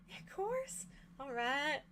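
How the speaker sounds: high, soft breezy reply; airy agreement. Acted. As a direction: toss it off lightly, way up high. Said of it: "she's like".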